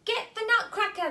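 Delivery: high, loud villain order; angry mouse cry. Acted. Mouse King and Queen ordering attack on the toy. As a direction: shout the order to charge. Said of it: "they cried".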